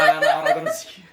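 high squeal through laughter; laughing. Real, not acted. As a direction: curse while cracking up. Swearing through big laugh.

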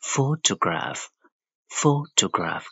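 PHOtograph, weight on the first syllable; stress on PHO. Shown as a demonstration. Teacher shows where pitch rises, stressed PHO in photograph. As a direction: lift PHO at the start of photograph. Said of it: "Pho ဆိုပြီးတော့အသံမြင့်ပါတယ်".

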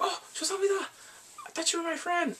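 high, whiny wail of betrayal; mock betrayed whine. Pushed into the pool, he wails at his friend in a high whine. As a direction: squeak it out like a wounded friend.

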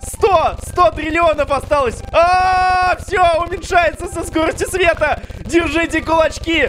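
screaming with excitement at the finish; excited shouting. Real, not acted. Boss health nearly gone.

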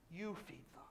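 hushed. Acted. Application to listeners, near whisper.

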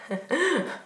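short, high-pitched laugh; mocking laugh. Woman mocks men who give you everything, mocking laugh. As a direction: laugh at the idea.

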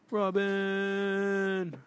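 high calling cry for Robin; high distant call. Acted.

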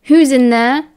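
sharp demand through a closed door; demanding call. A narrator's reading. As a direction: call out to whoever is inside. Stranger calls through the door.

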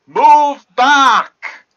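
loud shouted command through the wall. Pretend. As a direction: bellow it so he hears.

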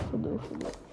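breathless whisper of dread; whispered panic. Real, not acted. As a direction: whisper it like the match is lost. Panic during ranked match. (sussurro em pânico)

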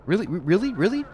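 asking "really?" in rising disbelief; incredulous really. Real, not acted. Gamer fuming at the game, incredulous rising "really?".

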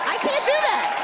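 shrill squeaked refusal; high squeal. Acted. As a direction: voice jumps way up on the refusal.

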